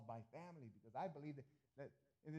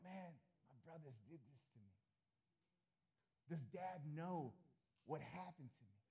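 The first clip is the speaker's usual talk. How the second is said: soft, hurt inner voice of Joseph; Joseph's inner lament; 4 dB quieter than usual. Acted. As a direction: muse quietly, hurt by the brothers.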